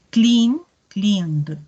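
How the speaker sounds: "cleaned" closing on a soft buzzing "d" after "Clean"; "cleaned" con D suave; soft voiced d ending. Shown as a demonstration. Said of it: "termina un poco más suave".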